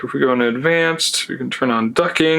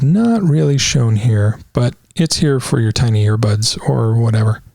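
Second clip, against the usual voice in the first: normal talking voice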